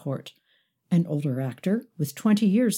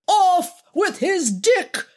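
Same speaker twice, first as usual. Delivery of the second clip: high, loud, drawn-out mock decree; slow, high-pitched theatrical shout; 13 semitones higher, 5 dB louder, slower than usual. A narrator's reading. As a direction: shout it like an imperious queen. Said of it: "shouted Alex".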